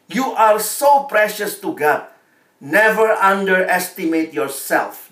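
usual speaking voice

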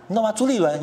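normal talking voice

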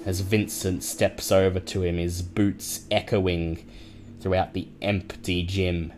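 measured game-master narration; dramatic scene narration. A narrator's reading. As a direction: paint the moment for the players. Grim aftermath narration.

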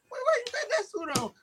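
squeaky-high imitation of someone asking; puzzled questioner. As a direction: pitch it way up, acting baffled. Acts out someone questioning the suit.

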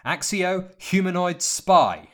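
loud, high, slow incantation; commanding spell shout. A narrator's reading. Harry summons the unseen spy, forceful slow shout.

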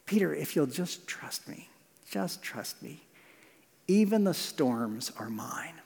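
soft, tender reassurance; very gentle and quiet, slowing at the end; Jesus, endeared. Acted. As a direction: drop to a hushed, loving plea. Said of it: "he's endeared to Peter".

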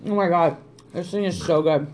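normal talking voice

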